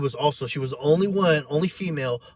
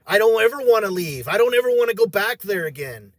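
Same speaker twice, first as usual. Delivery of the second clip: tearful pleading; 5 semitones higher, 4 dB louder than usual. Retold or imitated. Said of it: "And I just started crying".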